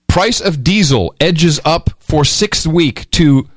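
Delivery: slow, deliberate news read with drawn-out numbers; headline read. Acted. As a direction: spell out the figure slowly for effect. Reads out a headline.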